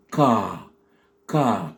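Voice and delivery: "car" with a long ah; slow English model "car". Shown as a demonstration. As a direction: draw out the vowel of "car". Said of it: "prononcée avec un A long".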